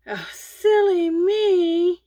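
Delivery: high, loud, slow sing-song silly me; mock self-scolding. Vlogger forgot the hat, mock self-scolding. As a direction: ham up scolding yourself, drawn out.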